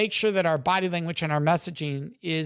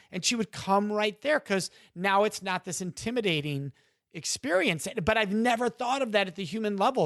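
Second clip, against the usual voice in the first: ordinary talk, nothing marked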